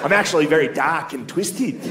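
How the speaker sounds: mock-serious Australian accent; imitated Australian, earnestly gloomy; Australian goth. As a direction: insist on your darkness as an Australian goth. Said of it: "You're Australian".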